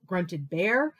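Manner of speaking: ordinary delivery, nothing marked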